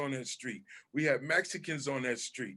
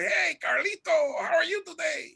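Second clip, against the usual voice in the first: high cartoon impression; cartoon voice at school; 14 semitones higher, 4 dB louder than usual. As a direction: talk like the cartoon to a friend.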